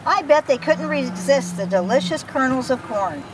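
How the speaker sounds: ordinary delivery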